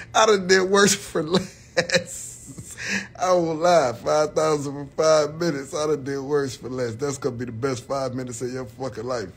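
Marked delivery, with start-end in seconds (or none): none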